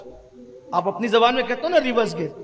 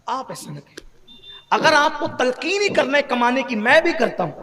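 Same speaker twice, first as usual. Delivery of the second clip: ordinary talk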